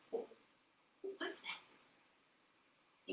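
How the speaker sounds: hushed surprised whisper. Acted. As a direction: breathe the question in wonder.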